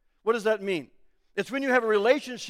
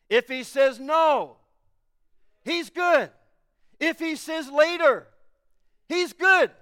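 higher, louder, slow building proclamation; preaching at full force; 4 semitones higher, 3 dB louder, slower than usual. Acted. Preacher proclaiming to the congregation that God is good, climbing full-force preaching. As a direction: build each line higher to the peak. Preaching climax on God's goodness.

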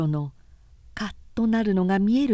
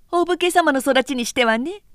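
sarcastic; 6 semitones higher, 5 dB louder than usual. Acted. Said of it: "おけいが皮肉に言った".

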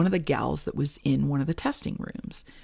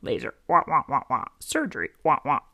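muffled wah wah talk with keywords; Charlie Brown teacher. Retold or imitated. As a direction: drone wah wah, pop the key words. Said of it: "kinda like the Charlie Brown teacher".

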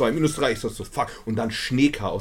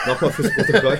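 laughing; 7 dB louder than usual. Real, not acted. Teasing friend about drinking.

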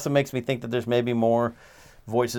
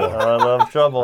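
laughing; 7 dB louder, slower than usual. Real, not acted. Laughing repeat of title.

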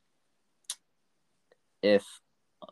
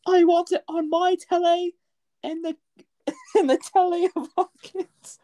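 put-on squeaky British accent breaking into laughs; high put-on British voice, cracking up; high mock British accent, laughing; 6 dB louder than usual. Mocking the Queen of England's wish, laughing in a British accent. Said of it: "continuing the Queen bit".

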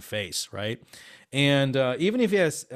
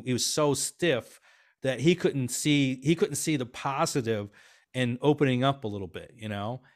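normal talking voice

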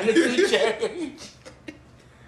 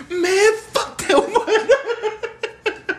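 slow laughing mock gripe; 13 semitones higher, 6 dB louder, much slower than usual. Acting frustrated gambler.

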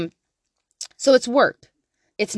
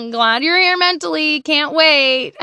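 higher, louder fake enthusiasm; sarcastic mock cheer; 6 semitones higher, 6 dB louder, slower than usual. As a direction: ham up a sarcastic welcome.

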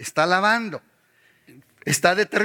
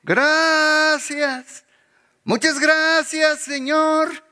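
mock Sunday joy; 7 semitones higher, much slower than usual. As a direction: act the fired-up worshipper. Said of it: "nos animamos y decimos".

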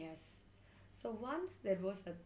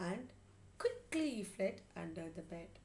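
ordinary delivery, nothing marked

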